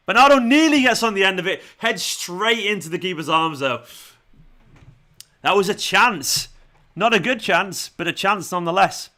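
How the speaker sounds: football commentary. Shown as a demonstration. Streamer calling a missed chance for viewers, excited commentary. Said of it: "I'll do some commentary towards moments".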